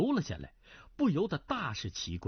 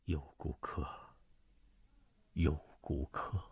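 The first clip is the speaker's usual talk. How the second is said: murmured to himself; 10 semitones lower, 5 dB quieter than usual. A narrator's reading. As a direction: breathe the words out half aloud. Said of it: "段正淳喃喃的道". (喃喃自语，低声气音)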